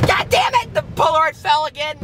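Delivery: high-pitched cry of frustration; exasperated outburst. Real, not acted. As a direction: burst out in a squeaky curse. Reacting to fallen Polaroid.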